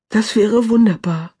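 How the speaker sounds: high, joyful exclamation; light up through the tears; delighted cry. A narrator's reading. Clara hears she may visit Heidi, a touch of glad excitement. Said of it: "rief Clara".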